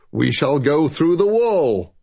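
a touch of a decisive boom. Acted. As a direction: boom it out with command. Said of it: "boomed Luthien Lim decisively".

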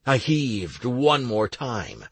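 plain reading voice

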